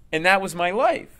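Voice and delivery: normal talking voice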